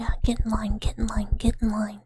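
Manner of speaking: play-acted threatening command; mock menace. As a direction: order them about in a forceful whisper. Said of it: "you just do the back and forth".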